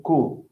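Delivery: "co" said as short "coo"; reduced syllable. Shown as a demonstration. Teacher isolating the sound for learner, "co" as "coo". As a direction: say "co" alone, like "coo". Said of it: "We say".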